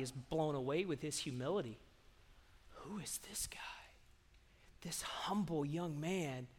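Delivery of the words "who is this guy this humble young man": hushed, wondering whisper; awed whisper. Acted. People marvelling at humble young David, whispering. Crowd murmuring in awe of David.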